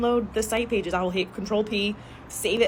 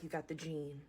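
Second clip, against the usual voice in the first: hushed, low, much softer warning; ominous school counselor; 4 semitones lower, 18 dB quieter than usual. Retold or imitated. School staff warning teens, hushed ominous murmur. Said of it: "they're like".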